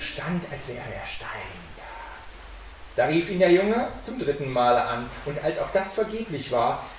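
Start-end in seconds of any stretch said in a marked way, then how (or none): 0.5-3.0 very soft, sinking narration; hushed suspense. A narrator's reading. Narrator describes the sexton standing like stone, hushed. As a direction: let it fade nearly to a whisper.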